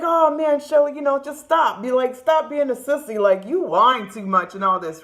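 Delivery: lightly voiced exasperated big brother; impatient brother. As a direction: brush off the whiny sister. Brother scolding her as sissy.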